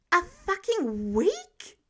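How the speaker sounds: can't believe he's benched for a week; outraged disbelief. Acted. Ordered to rest a week.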